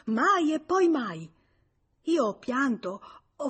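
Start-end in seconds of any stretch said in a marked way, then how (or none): 0.1-1.3 high, loud, drawn-out refusal; slow, emphatic cry quoting an outraged mother; retold yell. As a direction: imitate mother's yelled refusal. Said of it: "poi ha urlato".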